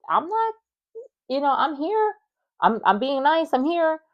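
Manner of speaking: high, strained insistence on being nice; exasperated protest. Retold or imitated. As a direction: plead that you're trying your best. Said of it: "I'm feeling".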